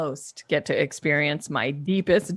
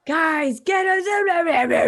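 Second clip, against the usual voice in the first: put-on high loud yelling at the kids; Dragon Mom shout; 10 semitones higher, 4 dB louder than usual. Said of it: "Dragon Mom".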